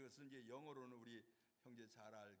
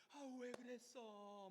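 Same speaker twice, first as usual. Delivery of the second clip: high, slow, aching 왜 그랬어; pained tender; 9 semitones higher, 3 dB louder than usual. Retold or imitated. Paul's tone changed to pain, high pained tender voice. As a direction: sigh it out with heartache. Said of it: "평소에 하던 말과 톤이 바꾸어지는".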